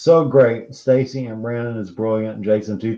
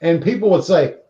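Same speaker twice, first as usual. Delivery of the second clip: normal talking voice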